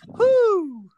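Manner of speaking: shrill high "hoo" sound; high hoot buzzer. Real, not acted. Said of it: "Mine's gonna be".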